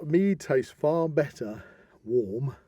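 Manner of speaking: ordinary delivery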